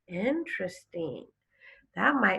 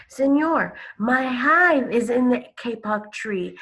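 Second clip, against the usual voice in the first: higher, louder busy bee voice; buzzing bee; 7 semitones higher, 6 dB louder than usual. Acted. Bee speaks in sleeping man's ear, higher buzzy voice. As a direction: pitch up and buzz like a little bee.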